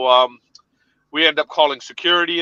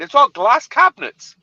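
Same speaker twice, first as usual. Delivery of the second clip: ordinary delivery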